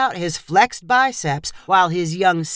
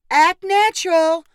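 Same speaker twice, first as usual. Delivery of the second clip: much higher, louder command; demanding; 11 semitones higher, 3 dB louder than usual. A narrator's reading. Photographer ordering couple to pose: high loud command. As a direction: order them to relax. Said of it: "Leanne demanded".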